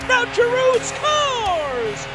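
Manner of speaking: long drawn-out goal yell, high; stretched goal shout. Real, not acted. Goal call in play-by-play.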